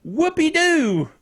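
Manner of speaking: high, drawn-out mock hooray; sarcastic cheer. Real, not acted. Host dismissing Apple's announcements, sarcastic cheer. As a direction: drag out a mock celebration.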